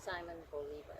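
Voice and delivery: ordinary talk, nothing marked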